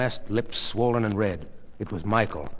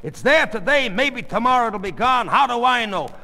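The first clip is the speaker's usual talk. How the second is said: raised, high, insistent voice; defensive arguing; 10 semitones higher, 5 dB louder than usual. Acted. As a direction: insist loudly that it's nothing. Husband makes excuses.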